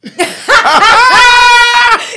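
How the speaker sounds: high, drawn-out cackle of a laugh; loud shrieking laugh. Real, not acted. Laughs hard at partner's wrong answer.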